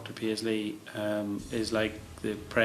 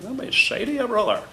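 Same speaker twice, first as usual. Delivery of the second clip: much higher, louder Ulster preacher voice; Paisley impression; 13 semitones higher, 7 dB louder than usual. Admirer imitating Paisley to interviewer, booming Ulster. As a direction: boom it like Doctor Paisley. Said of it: "he would talk like this".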